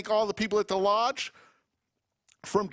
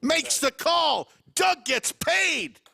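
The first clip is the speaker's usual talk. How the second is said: excited call; 6 semitones higher, slower than usual. Real, not acted. Poker play-by-play climax.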